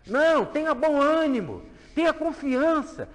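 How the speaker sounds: exortação aguda e forte; pregação fervorosa. Acted. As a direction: anima o ouvinte com fervor. (pregador exortando o fiel, com fervor)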